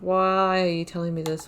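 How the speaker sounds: ordinary talk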